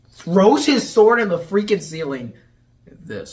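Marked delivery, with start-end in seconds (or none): 2.9-3.3 low, terse brooding imitation; Athos. Athos explaining loss, low brooding voice. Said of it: "character with all the deepness".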